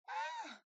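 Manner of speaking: high, loud "Ah!" of alarm; acted scream on being jolted awake; sudden high yelp; startled cry. A horrible scream wakes her up.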